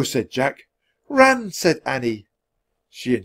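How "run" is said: put-on high, loud girl's cry; urgent call. A narrator's reading. Annie to Jack as they flee, calling out.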